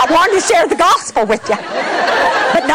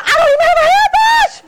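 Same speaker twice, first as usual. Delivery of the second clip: laughing cry; faster than usual. Retold or imitated. Protesting to God she has no hairbrush, high laughing wail.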